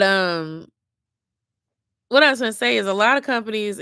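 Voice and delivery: usual speaking voice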